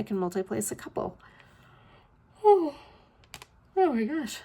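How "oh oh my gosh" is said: high, loud real yawn running into words. Real, not acted. Vlogger overtaken by repeated yawns, yawning. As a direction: exclaim through a big yawn. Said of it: "yawning a lot".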